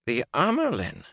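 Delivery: high, incredulous question; shocked exclamation. A narrator's reading. Liandrin challenges Moraine's order, exclaiming. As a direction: exclaim in disbelief. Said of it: "Liandrin exclaimed".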